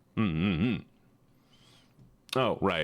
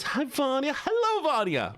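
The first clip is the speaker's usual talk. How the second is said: very high excited squeal; delighted greeting. Real, not acted. Streamer greets viewer Vanya, delighted squeal. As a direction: gush hello to a favourite.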